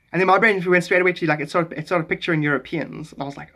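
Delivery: usual speaking voice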